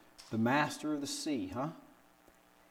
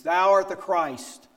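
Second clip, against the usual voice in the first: high, slow and louder, a firm confession; bold proclamation; 6 semitones higher, 9 dB louder, slower than usual. Retold or imitated. Peter answering Jesus, proclaiming it slowly. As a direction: declare it with conviction. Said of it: "Peter says".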